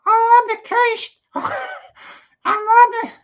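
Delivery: very high, loud puppet voice losing it; dummy cracking up. Acted. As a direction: laugh through the dummy's line. Dummy voice breaking into laughter.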